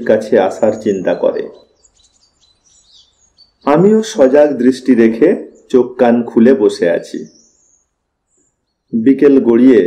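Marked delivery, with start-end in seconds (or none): none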